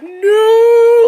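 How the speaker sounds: high loud drawn-out no; screaming no. Retold or imitated. Audience screaming as she falls, high and loud. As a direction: scream it with the crowd. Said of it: "actually screaming".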